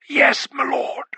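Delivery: a strained, muted whisper, pitched high; the frightened butler, barely holding his voice steady; hushed and strained, high. Acted. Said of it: "in such a strained and muted voice".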